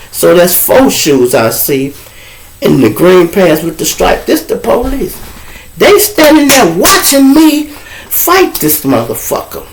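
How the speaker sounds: usual speaking voice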